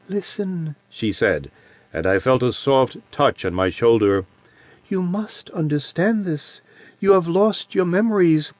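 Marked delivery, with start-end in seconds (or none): 0.1-0.7 a lightly voiced childlike creature, a touch higher and softer; soft childish voice of an ancient being; sweet childish voice. A narrator's reading. Adiran's voice held. 4.9-8.5 put-on sweet childish voice. A narrator's reading. Adiran's voice held.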